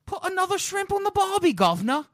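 high, hammed-up Aussie accent; mock Australian accent. Swapping accent jokes with a cohost, hammed-up Australian accent. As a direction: do a cartoon Australian, loud and high.

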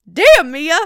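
loud gossip shriek; loud shocked exclamation. Real, not acted. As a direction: shout it out, scandalised. Reacting to shocking quote.

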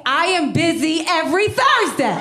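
high-pitched mock outrage, building to a shout; outraged refusal. Acted outraged refusal of suitor.

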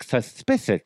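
lightly lisped Suspicious; lisp impression. Host imitating a lisper, a light lisp. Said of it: "first time I've actually done a lisp impression".

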